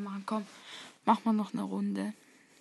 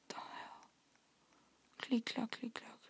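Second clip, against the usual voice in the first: whispering; 12 dB quieter than usual. Real, not acted. Whispering while sneaking.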